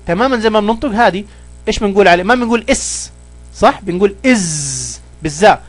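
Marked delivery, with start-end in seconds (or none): none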